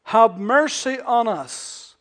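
loud, slow plea; crying for mercy. Retold or imitated. Lepers crying out, loud and slow. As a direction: cry it out long. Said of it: "And so".

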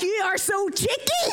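playful indignant cry; mock offended. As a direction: tease back in mock outrage.

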